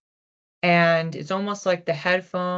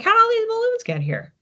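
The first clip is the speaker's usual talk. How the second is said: mock-baffled, pitched way up; baffled question; 3 dB louder than usual. Outie walks into the balloon room, high mock bafflement. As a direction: play it high and incredulous, like finding a mess you never made. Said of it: "you come in the next day and you're like".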